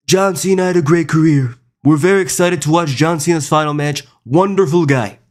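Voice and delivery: put-on presidential impression; mock president. President arriving for a wrestler's final match, mock presidential voice. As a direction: praise grandly in a put-on politician's voice. Parodies the president praising John Cena.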